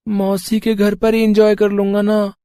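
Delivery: put-on high young boy's voice, sulky; a small boy insisting he'll have fun; child voice. Little Bunty talking to his parents, high child voice.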